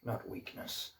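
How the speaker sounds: put-on soft, low Irish-accented horse; quiet Irish accent, lightly put on. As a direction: speak gently in an Irish accent, trailing to a near whisper. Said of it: "do it better in his Irish accent".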